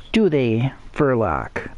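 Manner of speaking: ordinary talk, nothing marked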